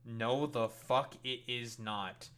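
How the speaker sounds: slow, each word landed deliberately; No, the fuck it is not dragged out in disbelief; slow emphatic denial. Real, not acted. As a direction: spell it out slowly, flatly refusing. Flat rejection of the claim.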